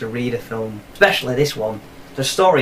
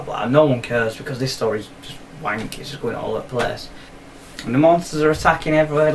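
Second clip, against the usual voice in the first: ordinary delivery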